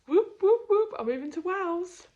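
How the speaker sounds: high, loud celebratory whoops; whooping cheer. Real, not acted. Celebrating moving to Wales with loud whoops. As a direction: cheer like you've won. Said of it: "I'm so friggin' excited".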